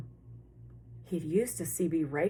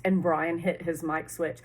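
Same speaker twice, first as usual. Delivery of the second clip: usual speaking voice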